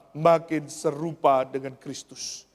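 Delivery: ordinary delivery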